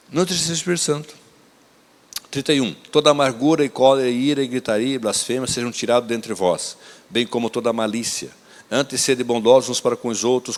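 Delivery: solemn reading to the church; scripture reading. Acted. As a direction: read the verses out with weight. Reads Ephesians aloud to congregation.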